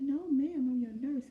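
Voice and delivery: high, loud nurse voice cutting through; nurse calling out. Retold or imitated. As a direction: raise the voice over the patient's screams. Nurse answers screaming patient.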